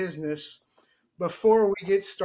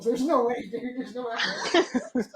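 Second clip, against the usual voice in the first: higher, laughing refusal; laughing protest; 6 semitones higher, slower than usual. Real, not acted. Host refusing to keep wearing the hat, laughing. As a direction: protest helplessly through a laugh.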